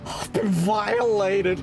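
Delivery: slow, drawn-out mock outrage; milk it like a violated victim.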